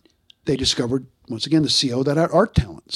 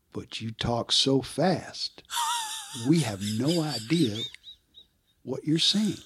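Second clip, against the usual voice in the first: imitating slow sponsor; 8 dB quieter, slower than usual. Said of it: "he said".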